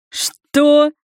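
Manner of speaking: loud, rising disbelief; stunned, high-pitched outburst; astonished. A narrator's reading. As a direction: blurt it out in high surprise. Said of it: "Я не смог сдержать удивление".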